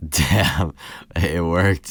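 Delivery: retold loud, amazed reaction; impressed outburst. Speaker retelling a moment to a co-host, loud impressed reaction. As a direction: re-enact being impressed, loud and emphatic.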